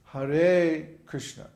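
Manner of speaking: "Hare" with a long, loud "re", then short "Krishna"; long-short syllable lengths. Shown as a demonstration. Teacher demonstrating Sanskrit lengths, "Hare" drawn out, "Krishna" short. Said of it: "the second syllable is long".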